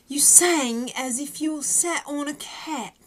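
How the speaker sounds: a terrible fake British accent; bad British accent. Shown as a demonstration. Quoting Simon's line to a singer in bad British accent. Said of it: "that was a horrible British accent".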